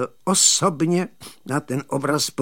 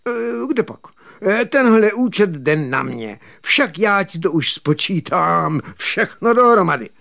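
high, loud voice of a lordly prince; loud, raised-pitch aristocrat taking charge; prince speaking; 5 semitones higher, 5 dB louder than usual. Acted. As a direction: put on the prince, pitched up and forceful. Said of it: "ale kníže pán povídá".

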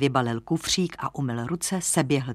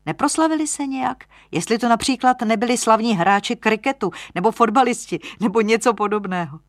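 high, loud, insistent lodger voice; puzzled young lodger; 6 semitones higher, 5 dB louder than usual. Acted. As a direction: think aloud eagerly, pitched well up. (zaujatý mladík, naléhavě a výš)

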